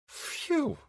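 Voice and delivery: high whistling phew of being flustered; playful overheated exhale; mock phew. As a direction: blow out a hot, flustered phew. Reacting to the red nightgown.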